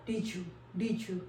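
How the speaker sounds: "did you" slow and soft, blended into "didju". Shown as a demonstration. Teacher models the d-y merge for learners. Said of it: "जे का sound".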